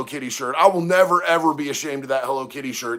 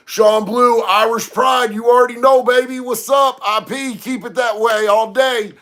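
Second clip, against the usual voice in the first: loud, high, punchy greeting; rowdy, raised-voice hype; hyped shout-out; 5 semitones higher, 4 dB louder than usual. Real, not acted. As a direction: holler it to a buddy across the room. Hypes up viewer by name.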